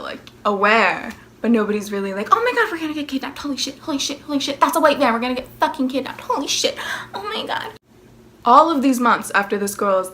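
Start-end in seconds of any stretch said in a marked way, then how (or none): 2.3-7.7 fast, breathless mock panic. Classmates freaking out about kidnappers, mock panic.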